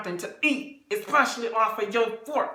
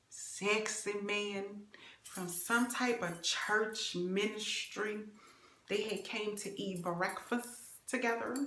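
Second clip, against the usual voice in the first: hushed gossip; 8 dB quieter, slower than usual. Real, not acted. Hushed juicy gossip detail.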